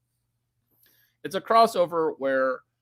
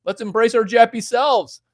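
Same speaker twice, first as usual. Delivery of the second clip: hammed-up louder and higher; louder, higher repeat; 8 semitones higher, 7 dB louder than usual. Shown as a demonstration. Streamer to chat, repeating his joke louder and higher. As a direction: belt it out as a joke.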